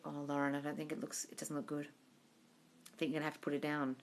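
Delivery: mock vet, soft and sorry; gentle bad news.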